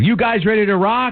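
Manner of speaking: high-pitched rock frontman holler; mock stage yell. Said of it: "he yells out".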